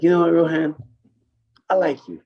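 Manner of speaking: high, loud approving boss; Kenny telling Rohan he likes him, high and loud; imitating Kenny. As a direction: pitch it up like Kenny sizing him up. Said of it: "That sounds like Kenny".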